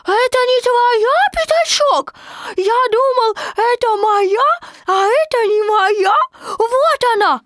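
put-on very high, loud excited child; a tiny joey fussing over sticks; squeaky eager kid chattering; little Roo voice. A narrator's reading.

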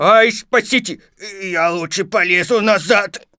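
high, loud cry for help; panicked cry. Acted. Pooh stuck in the hole, crying out loudly. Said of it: "Винни-Пух закричал".

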